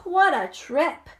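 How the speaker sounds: usual speaking voice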